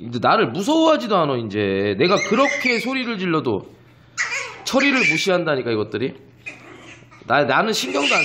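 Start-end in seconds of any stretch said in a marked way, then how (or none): none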